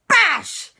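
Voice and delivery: loud, high smack; bash impact. Acted. Papa bashes the ghost mid-chat, loud impact shout. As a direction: shout the blow suddenly. Imitates a blow.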